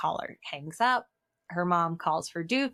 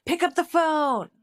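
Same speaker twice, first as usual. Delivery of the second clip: high, loud call across the room; 10 semitones higher, 6 dB louder than usual. Retold or imitated. Shorty calling to his friend, a high loud call. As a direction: shout it to a friend in another room. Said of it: "Shorty calls to his friend".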